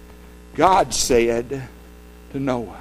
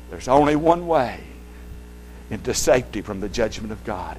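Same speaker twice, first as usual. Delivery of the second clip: ordinary talk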